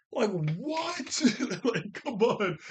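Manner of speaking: high-pitched, incredulous laugh in the words; laughing disbelief. Real, not acted. Recapper mocks villagers ignoring the smoke, laughing in disbelief. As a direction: exclaim in baffled amusement, laughing.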